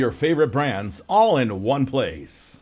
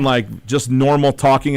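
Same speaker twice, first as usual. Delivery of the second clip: normal talking voice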